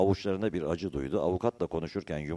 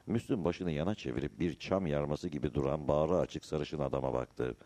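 ordinary delivery, nothing marked

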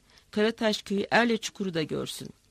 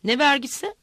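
louder and higher, pressing each word; angry shouted demand; shouting, leaning on words; 4 semitones higher, 6 dB louder than usual. A narrator's reading. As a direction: hurl the question at him. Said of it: "bağıra bağıra sözlerin üstüne yüklene".

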